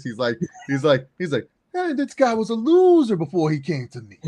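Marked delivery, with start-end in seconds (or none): 1.7-4.2 high, loud smug wrestler impression; Roman Reigns. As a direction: crow it like a boastful chief.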